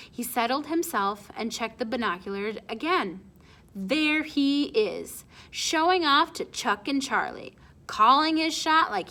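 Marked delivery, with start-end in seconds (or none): none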